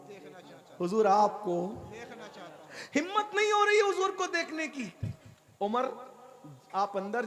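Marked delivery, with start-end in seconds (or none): 0.1-0.8 breathy whispered longing. Shown as a demonstration. Young man at the door whispers his wish to see the Prophet. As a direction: drop to a bare whisper, as if afraid to ask. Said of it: "आपकी तवज्जो है ना?".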